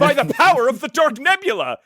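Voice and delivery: loud laughing alien proclamation; mock battle cry. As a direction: bellow it like a cartoon villain.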